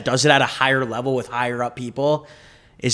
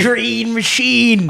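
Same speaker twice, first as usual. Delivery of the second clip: high, loud, stretched-out holler; hollered nickname; 10 semitones higher, 6 dB louder, much slower than usual. Acted. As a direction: draw it out like a hype chant. Hollering the nickname over the story.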